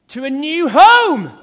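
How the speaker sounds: emphatic. Real, not acted. Storytelling climax.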